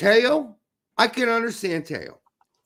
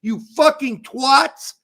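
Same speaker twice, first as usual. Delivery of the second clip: slow, loud swearing; angry insult; 4 semitones higher, 7 dB louder, slower than usual. Real, not acted. Cursing the players who took the penalties, slow and loud. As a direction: drag the insult out in anger.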